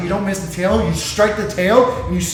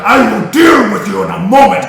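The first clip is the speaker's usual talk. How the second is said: menacing monster threat; 3 semitones higher, 9 dB louder than usual. Acted. Monster speaks mid-fight.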